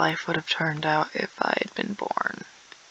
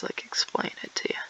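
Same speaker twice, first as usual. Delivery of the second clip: soft unvoiced whisper; 8 dB quieter than usual. Teasing viewers, whispering she won't explain. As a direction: let the words drop to a breath.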